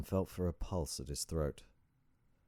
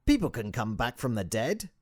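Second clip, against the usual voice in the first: put-on high, loud, accented guru; emphatic sage; 7 semitones higher, 7 dB louder than usual. A narrator's reading. Sage cutting in on doubting visitor, emphatic and accented. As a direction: agree eagerly, high and loud. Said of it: "he said, nodding furiously".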